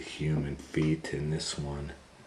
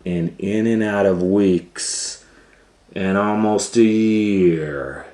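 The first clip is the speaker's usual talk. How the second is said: loud, slow, rising storybook voice; dramatic storytelling; 5 semitones higher, 10 dB louder than usual. A narrator's reading. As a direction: build the voyage grandly.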